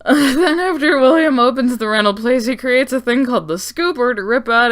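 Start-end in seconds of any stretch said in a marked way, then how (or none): 0.0-2.5 real laughter breaking through; laughing. Real, not acted. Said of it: "oh my God".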